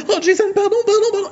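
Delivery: squeaky high, flustered apologies; mock romcom girl apologizing; flustered Brenda. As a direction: play the clumsy heroine in a high giggly voice. Brenda voiced in parody story.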